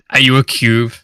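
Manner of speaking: normal talking voice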